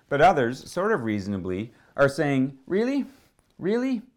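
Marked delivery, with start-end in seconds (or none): 2.7-4.0 sharply high, disbelieving British-free Really? Really?; incredulous really. Retold or imitated. Doubting onlookers scoffing at Jesus's tears, incredulous.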